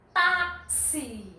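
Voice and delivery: táxi with TA held long; stress on TA. Shown as a demonstration. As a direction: draw out the TA in táxi, then a short xi. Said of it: "O T e o A". (táxi alongando o TA)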